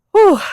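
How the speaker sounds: loud high exhale of relief; breathless whew. Real, not acted. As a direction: blow out a winded whew. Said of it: "Still a little out of breath".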